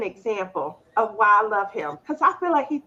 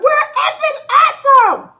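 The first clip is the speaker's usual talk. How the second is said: much higher, loud cheer through laughter; laughing kid cheer; 14 semitones higher, 10 dB louder than usual. Retold or imitated. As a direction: yell it gleefully while laughing. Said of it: "He's like".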